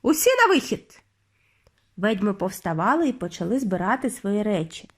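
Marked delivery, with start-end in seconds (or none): 0.0-1.0 high-pitched order to the hall; bossy witch's dismissal; commanding call. A narrator's reading. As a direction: send everyone out with a high call.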